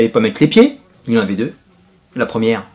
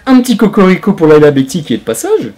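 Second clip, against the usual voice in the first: proud shout-out; 7 semitones higher, 8 dB louder than usual. Real, not acted. Patriotic shout-out.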